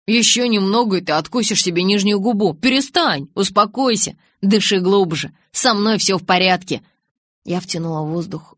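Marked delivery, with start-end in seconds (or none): 0.1-6.8 loud, raised, pressing commands; agitated, forceful reassurance; urgent insistence. A narrator's reading. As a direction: snap her out of a faint. Urging a fainting friend to calm down.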